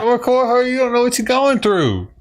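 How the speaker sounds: high mocking imitation of a scolding woman; mocking bystander. Mocking the bystander who scolds people filming, high sneering imitation.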